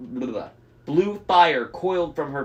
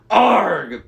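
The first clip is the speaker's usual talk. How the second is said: loud pirate roar, higher; pirate bellow; 5 semitones higher, 9 dB louder than usual. A narrator's reading. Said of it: "bellowed the big man".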